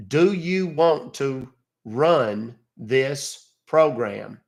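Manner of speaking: drags Do you want to run this program out word by word; very slow spelled-out reading. Shown as a demonstration. Caller reading the screen to support at a crawl. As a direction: read it at a crawl, like teaching a slow learner. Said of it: "monkeys in Russia that I could teach".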